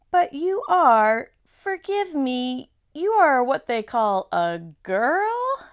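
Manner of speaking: high, slow, wondering faun; slow puzzled faun voice; slow fawn voice. Acted. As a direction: draw it out, amazed faun. Reads fawn dialogue.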